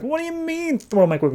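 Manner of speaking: high-pitched, incredulous panic; freaking out. Acted. Oliver questions Henry's odd advice, freaking out. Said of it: "He's freaking out".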